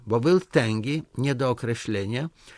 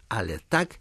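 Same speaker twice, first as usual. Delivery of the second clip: usual speaking voice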